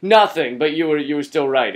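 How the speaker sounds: ordinary talk, nothing marked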